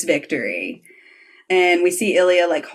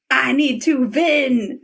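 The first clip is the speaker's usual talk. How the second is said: put-on slow, high Russian accent; imitated Russian athlete's accent, drawn out; 7 semitones higher, slower than usual. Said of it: "like, with the accent".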